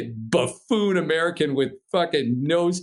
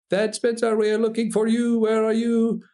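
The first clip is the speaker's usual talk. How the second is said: put-on high, even French-accented radio call; imitated French accent; 10 semitones higher than usual. Instructor calling lost climber on the radio, imitated French accent. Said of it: "it's the instructor".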